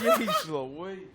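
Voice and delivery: loud, high amazed exclamation; shocked at the matching tattoo; astonished. Real, not acted. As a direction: blurt it out in disbelief.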